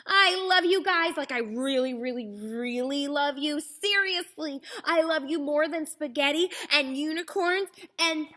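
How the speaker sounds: high, loud gushing thanks; gushing love. A narrator's reading. Wordy Birdie thanking her friends, high and gushing. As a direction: pour it all out to your friends.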